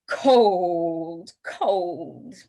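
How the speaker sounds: "cold" stretched out as if freezing; exaggerated cold. Shown as a demonstration. Teacher showing the sign for really cold, exaggerated shivering delivery. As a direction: act being really cold, dragging out each word. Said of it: "We just exaggerate it".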